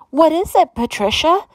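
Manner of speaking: high, alarmed call; crying out. A narrator's reading. Harriet to her screaming sister, alarmed cry. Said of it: "Harriet cried".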